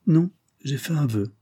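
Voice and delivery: usual speaking voice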